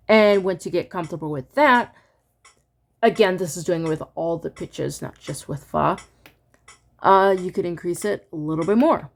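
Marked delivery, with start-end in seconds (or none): none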